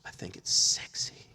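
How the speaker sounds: slow, breathy imitated whisper; sultry whisper. Woman tells why she liked funeral work, in a sultry whisper. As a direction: mimic a seductress, whispering slowly.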